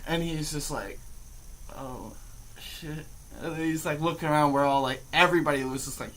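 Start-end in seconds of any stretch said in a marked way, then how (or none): 1.8-3.1 put-on stoned kid. Dazed kid stops in a classroom doorway, stoned and quiet. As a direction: let it out softly, slowly dazed. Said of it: "he looks just as high".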